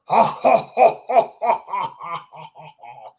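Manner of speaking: loud, high booming laugh fading off; big gloating villain laugh; hearty laugh. Acted. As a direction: laugh in his face. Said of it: "let out a hearty laugh".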